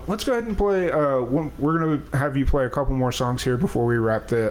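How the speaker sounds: normal talking voice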